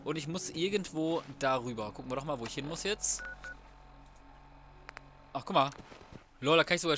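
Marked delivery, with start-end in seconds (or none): none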